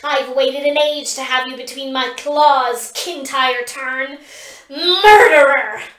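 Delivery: slow, building roar into Murderer; vengeful creature roar; furious dragon. A narrator's reading. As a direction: hurl the accusation with rising rage. Dragon accuses Kintyre.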